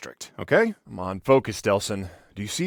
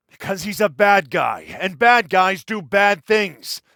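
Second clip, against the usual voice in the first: raised, louder, emphatic scolding; exasperated scolding; 7 semitones higher, 6 dB louder, slower than usual. Acted. Brother telling Delsin why the bad guy did it, exasperated scolding. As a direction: hammer it home to a brother who won't listen.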